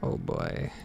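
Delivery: normal talking voice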